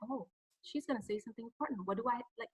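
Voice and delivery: very hushed, quick aside; imagined audience member. Acted. Coach voices the audience awaiting news, hushed and quick. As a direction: voice an intrigued listener's private thought, very softly. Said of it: "gives your audience more time".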